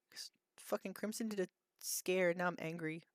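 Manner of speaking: hushed unvoiced grumble; whisper. Real, not acted. Streamer telling chat why she's angry, hushed whisper. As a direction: whisper it like a sulky secret. Whispering complaint about scare.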